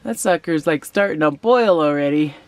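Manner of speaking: normal talking voice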